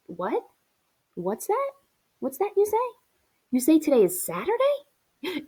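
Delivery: high, rising disbelief, with pauses between questions; surprised questioning. Acted. Sick child hearing it's Saturday, surprised. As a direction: break off, then question in rising amazement.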